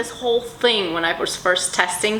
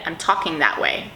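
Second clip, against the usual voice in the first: normal talking voice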